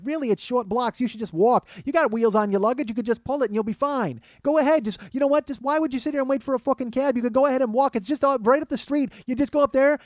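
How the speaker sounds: high-pitched, relentless New York jabber; jabbering nag. Retold or imitated. Woman jabbering in comedian's ear, nagging. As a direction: keep pestering, pitch climbing as it runs on. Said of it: "she's jabbering in my fucking ear".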